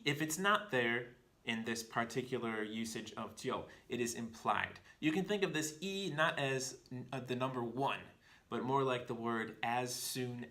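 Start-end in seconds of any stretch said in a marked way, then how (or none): none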